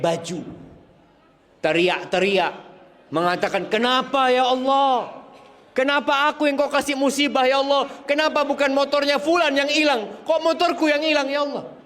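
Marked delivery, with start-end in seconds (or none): 3.7-11.7 high, wailing complaint to God; imitating a bitter griever's wail; anguished lament. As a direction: cry out why me, pleading. Grieving man wails at God.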